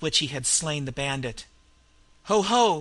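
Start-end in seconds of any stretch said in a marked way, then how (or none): 2.2-2.8 loud, high roared ho-ho; roaring laugh. A narrator's reading. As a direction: boom out a madman's laugh. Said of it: "he roared".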